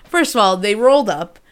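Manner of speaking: ordinary delivery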